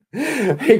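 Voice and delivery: ordinary talk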